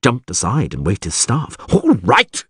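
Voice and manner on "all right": high, exasperated outburst; shouted. A narrator's reading. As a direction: give in with an angry shout. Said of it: "he shouted".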